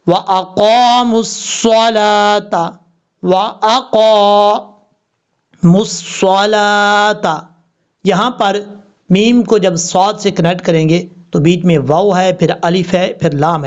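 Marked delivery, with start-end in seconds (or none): 0.1-7.6 very slow chanted recitation; Quranic recitation. Shown as a demonstration. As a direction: intone it slowly like tajweed.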